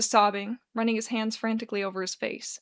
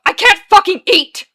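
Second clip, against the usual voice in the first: very high, very loud wail; wailing yell; 9 semitones higher, 16 dB louder than usual. A narrator's reading. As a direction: wail it so it fills the room. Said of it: "Arthur's wails ricochet throughout the small room".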